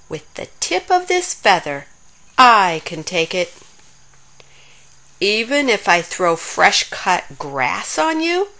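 ordinary delivery, nothing marked

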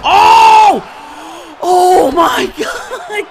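sudden loud high yell; startled yell. Real, not acted. Gamer yelling at a sudden hit in the fight. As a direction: burst out in shock.

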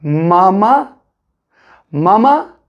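Mama joined syllable by syllable into one word; blended word mama. Shown as a demonstration. Teacher blending two syllables for children. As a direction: link both ma syllables smoothly. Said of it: "Birleştirelim".

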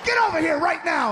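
stern order. Retold or imitated. Wife orders husband.

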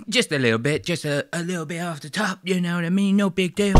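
light mock British accent. Vlogger mimics Trump shrugging off his ear, light British accent. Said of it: "I went, uh, British with Donald Trump".

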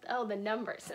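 ordinary talk

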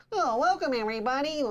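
high, put-on host voice; DM welcome voice. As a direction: greet the table in a silly high voice. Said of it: "I'll be like".

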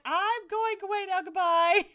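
high, loud silly exit line; hammed-up cartoon goodbye; playful high voicing of the husband; mock sim voice. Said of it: "He's like".

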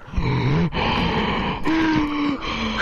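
raspy mechanical breathing; choking Vader breath; Darth Vader breath. Pretend. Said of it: "you sound like you're choking on your own lungs".

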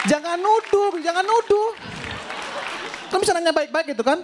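very high whiny "jangan nuduh"; mock whiny plea. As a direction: whine like a wronged child. (merengek dengan suara sangat tinggi)

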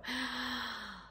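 sharp whispered gasp of delight; excited gasp. Acted. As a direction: gasp as if it just came true. Imagining it has happened.

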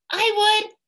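very high, keen volunteer; eager Wombat. A narrator's reading. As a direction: squeak it out hopefully.

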